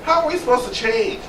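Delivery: rising, pleading questions, pushed high; impassioned plea. Real, not acted. Asking the audience how anyone can grow. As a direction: press the point like a plea to the room.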